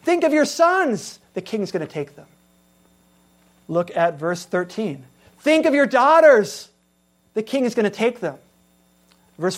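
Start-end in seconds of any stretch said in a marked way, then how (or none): 0.0-2.3 "Think" raised high then falling away; warning. Acted. Preacher voicing God warning Israel about the king. 5.4-8.4 louder, higher "daughters", falling; loud warning. Acted. Preacher voicing God warning Israel about their daughters.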